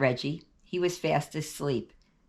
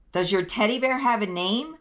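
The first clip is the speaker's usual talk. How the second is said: higher and a little louder, repeating; 7 semitones higher, 4 dB louder than usual. Shown as a demonstration. Ira repeating his question to sleepy Reggie, louder. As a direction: raise the voice so he hears the question. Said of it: "I said louder".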